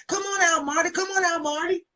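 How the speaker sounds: high calling out to someone hidden. Retold or imitated. Father coaxing the missing grandson out, high calling out. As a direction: call him out of hiding, coaxing.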